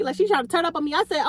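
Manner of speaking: ordinary delivery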